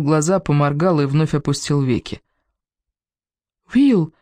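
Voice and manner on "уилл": loud high cry of his name. A narrator's reading. Stella cries out to Will as he opens his eyes, loud and high. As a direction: shout to pull him back. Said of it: "крикнула Стелла".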